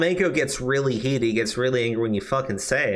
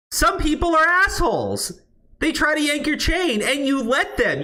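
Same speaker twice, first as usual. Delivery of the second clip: much higher, whiny sarcastic voice; mocking falsetto rant; 12 semitones higher, slower than usual. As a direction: sneer it in a high mocking voice. Mocking a streamer, high falsetto.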